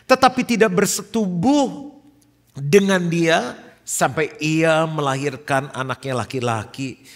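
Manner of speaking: lantang dan khidmat, mulai tinggi; membaca ayat Alkitab. Real, not acted. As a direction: bacakan firman kepada jemaat dengan tegas. (pembacaan ayat yang lantang)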